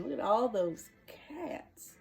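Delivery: usual speaking voice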